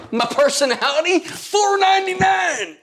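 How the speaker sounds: high, slow hammed-up shout; mock sales shout. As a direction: bellow the price like a pitchman. Joking sales pitch shout.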